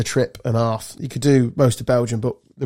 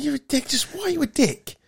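much higher, softer incredulous voice; exasperated mock retort; 12 semitones higher, 5 dB quieter than usual. Exasperated retort to the obnoxious Cockney.

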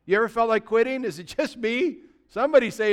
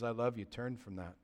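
much softer, tender voicing of Jesus; soft, loving rebuke; gentle Jesus; 9 semitones lower, 16 dB quieter than usual. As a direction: speak it like gentle correction from Jesus. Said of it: "with gentleness and says".

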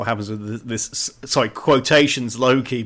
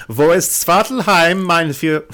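mock German accent; 3 semitones higher than usual. Host joking about his own slip, mock German accent. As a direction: put on a comic German accent. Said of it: "I stuck a German accent".

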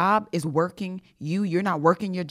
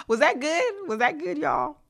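playful; 10 semitones higher than usual. Real, not acted. Playful check after impression.

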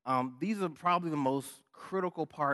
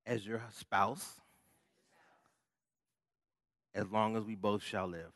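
saying wedding vows. Shown as a demonstration. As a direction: recite the vows line by line. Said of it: "repeat after me".

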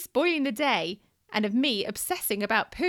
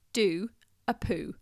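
mum's command; 4 semitones lower, slower than usual. Retold or imitated. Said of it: "I said to him".

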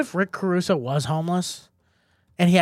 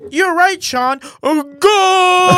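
very high, much louder commentator yell; goal shout; 13 semitones higher, 10 dB louder, much slower than usual. Pretend. Mocking the Spanish phrase with a commentator's goal yell. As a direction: scream it like a soccer announcer losing it. Mock soccer commentator yelling.

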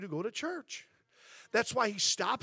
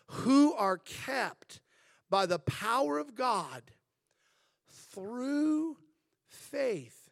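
very slow, weighty reread; slow scripture emphasis; 3 semitones higher, much slower than usual. Acted. Pastor rereading the verse to the church, very slow. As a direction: draw each word out for emphasis.

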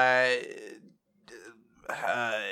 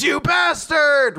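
high, slow, loud furious shout; screaming; 6 dB louder, much slower than usual. Retold or imitated. Yuno screaming at Zeno after friends die, loud slow shout. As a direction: scream it at the enemy. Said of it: "He screams".

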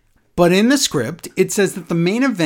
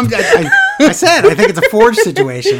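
laughing; 14 semitones higher, 8 dB louder than usual. Real, not acted. Laughing at own joke.

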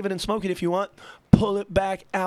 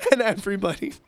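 laughing; 6 semitones higher, faster than usual. Real, not acted. Laughing while telling.

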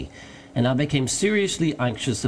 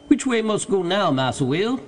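higher servant voice calling down; Jupiter voice; 7 semitones higher than usual. A narrator's reading. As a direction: call down from up a tree. Narrator voices Jupiter up tree.

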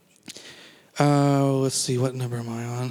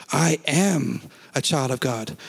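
slow, hushed and weighty; anointed declaration; slower than usual. Retold or imitated. Pastor recalling confessing alone at home, slow and weighty. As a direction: say it as if power rests on the words. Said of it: "there was anointing on it".

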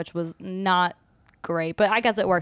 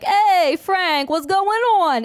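loud, high New York Italian; hammed-up Italian goofball greeting; bad Italian accent that drifts New York; 9 semitones higher, 3 dB louder, much slower than usual. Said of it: "in an Italian accent".

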